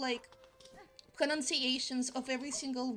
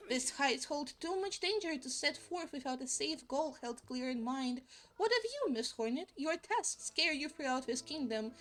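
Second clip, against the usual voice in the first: normal talking voice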